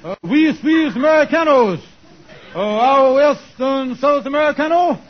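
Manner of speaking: hammed-up broken Spanish for a local. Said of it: "I speak a little broken Spanish here".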